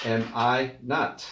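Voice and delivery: slow, careful "Am I not?"; slow model phrase. Shown as a demonstration. Teacher to class, slowly modelling the phrase. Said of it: "no hay una contracción".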